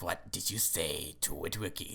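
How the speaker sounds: very low, hushed imitated menace; menacing villain voice. Evil alien condor questioning Jerry, low menace. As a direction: play an evil creature's quiet threat.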